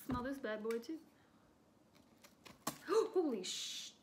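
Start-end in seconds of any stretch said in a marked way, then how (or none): 2.2-3.1 sharp high gasp; startled gasp. Real, not acted. Product spills everywhere. 3.1-3.9 surprised. Real, not acted. Product spills everywhere.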